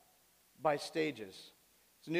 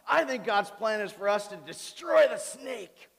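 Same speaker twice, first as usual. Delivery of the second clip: put-on loud, higher, slowed-down defiant five-year-old; kid with attitude; 4 semitones higher, 6 dB louder than usual. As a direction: imitate a bold little boy, lip curled. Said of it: "him with the attitude and the lip curled up".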